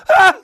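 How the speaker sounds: loud high yell; a sudden wild scream; natural holler. Retold or imitated. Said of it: "his natural holler".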